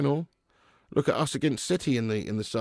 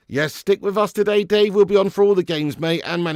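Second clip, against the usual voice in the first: calling out high and loud to a chat viewer; 8 semitones higher, 11 dB louder than usual. Real, not acted. Streamer calling out loud to viewer Dave. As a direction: belt it out like a greeting across the room.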